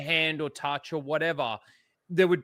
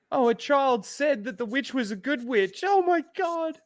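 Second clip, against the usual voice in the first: very high mock-emotional squeal; hammed-up overwhelmed star in a press interview; mock gushing; 9 semitones higher than usual. Co-host mocking the tearful Wicked press tour, mock gushing.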